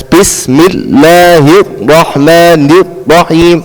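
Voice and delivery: slow, segmented recitation; recited syllable by syllable. Shown as a demonstration. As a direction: break it up slowly, piece by piece. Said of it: "we recite like this".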